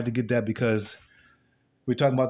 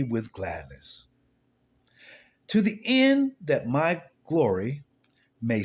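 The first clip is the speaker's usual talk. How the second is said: slow, solemn psalm reading, slightly raised; scripture reading; 4 semitones higher, slower than usual. Acted. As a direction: read the psalm like a preacher. Reads psalm aloud.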